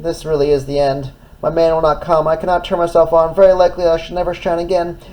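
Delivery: despairing. Acted. Despairing lighthouse.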